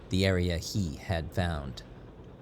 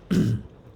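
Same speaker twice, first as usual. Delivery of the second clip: throat clearing; 6 semitones higher, 7 dB louder than usual. Acted. Kai coughed.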